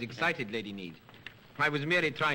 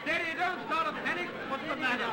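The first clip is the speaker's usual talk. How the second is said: shouting; 13 semitones higher than usual. Acted. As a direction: yell to keep the crowd back. Lights out, calming a panic.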